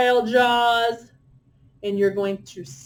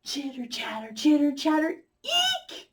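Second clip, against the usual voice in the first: chattering monkey, squeaking high on eek; monkey chitter ending in a high squeak; silly monkey chatter; 6 semitones higher than usual. Shown as a demonstration. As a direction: play a silly little monkey. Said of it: "Your turn".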